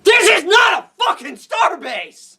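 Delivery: real fan outrage; furious shout. Real, not acted. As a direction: yell it in indignation. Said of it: "outrage about the term".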